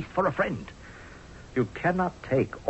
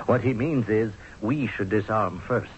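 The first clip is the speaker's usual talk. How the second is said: ordinary talk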